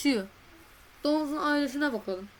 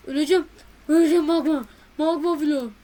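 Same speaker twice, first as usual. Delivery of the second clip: loud panicked cry, voice up high; panicked shout; 4 semitones higher, 10 dB louder, faster than usual. Real, not acted. Gamer panicking on a magma block, loud panicked cry. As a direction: yell in panic as the magma burns.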